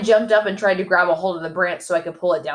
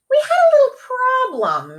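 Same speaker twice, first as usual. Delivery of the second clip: Robbie's voice; 6 dB louder than usual. A narrator's reading. Robbie sheepish to dad.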